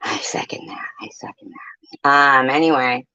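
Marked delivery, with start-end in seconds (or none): 0.0-2.1 breathy whispered aside. Real, not acted. Whispering agreement to a chat comment.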